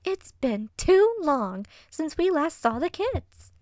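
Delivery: high, loud, drawn-out vixen squeal; bouncing excitement. Acted. Vixen eager to see the kids, excited. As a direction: gush it, stretching the key words. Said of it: "practically bouncing on her hind legs".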